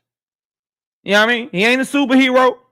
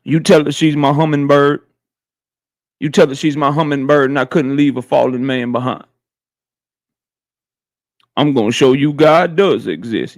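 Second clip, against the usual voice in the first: movie quote impression; 8 semitones lower than usual. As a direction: drop the voice deep like a film hero quoting his line. Quoting The Rock movie lines.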